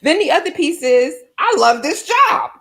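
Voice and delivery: high and loud, laughing through the words; bubbling over with real delight; gushing happily to the guest about success; excited laughter. Real, not acted. Excited, laughing about loving the job.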